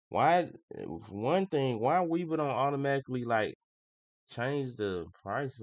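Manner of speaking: loud, high-pitched griping; exasperated complaint. Real, not acted. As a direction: burst out complaining about the app. Exasperated complaint about trading app.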